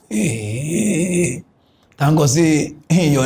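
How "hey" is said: soft squealed "hey" wail; mock wail. Host lamenting the kidnapping law, mock wailing. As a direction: squeeze out a quiet, crying cry of dismay.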